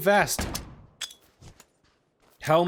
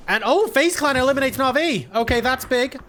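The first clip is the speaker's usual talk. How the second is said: higher, surging esports commentary; excited play-by-play; 7 semitones higher, 4 dB louder than usual. Real, not acted. Caster announces FaZe Clan eliminating Na'Vi, excited. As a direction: call the elimination with a burst of excitement.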